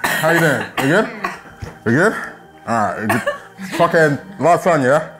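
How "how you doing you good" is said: ordinary delivery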